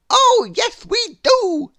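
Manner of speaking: very high, loud eager exclamation; excited cry. Acted. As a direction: burst out with a bright new idea. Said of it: "he cried".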